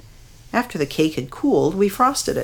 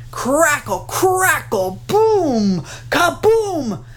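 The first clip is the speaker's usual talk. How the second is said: bellowing thunder; 10 semitones higher, much slower than usual. A narrator's reading. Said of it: "the thunder bellowed".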